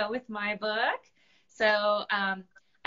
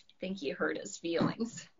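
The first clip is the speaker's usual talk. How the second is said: plain reading voice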